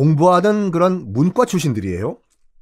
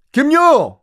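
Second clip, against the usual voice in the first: calling out; 11 semitones higher, 4 dB louder, much slower than usual. Acted. Calling for the missing leader.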